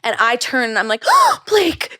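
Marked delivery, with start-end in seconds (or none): 1.2-2.0 high-pitched, breath-caught recognition; starstruck gasp. Retold or imitated. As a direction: re-enact spotting an idol in the street.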